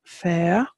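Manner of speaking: "fair" with a long open vowel, ending on a rise; modern Irish pronunciation. Shown as a demonstration. Teacher giving the modern Irish form, careful pronunciation. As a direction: stretch out "fair" as an Irish word. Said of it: "I've given the modern Irish".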